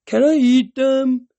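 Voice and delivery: a monster drooling over its next meal; hungry giant. Acted. Hungry giant asks to eat heroes.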